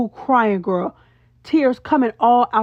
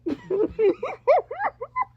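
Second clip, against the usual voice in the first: high squealing laugh; 14 semitones higher, 4 dB quieter than usual. Real, not acted. Laughing at Scotty's answer, high squeal.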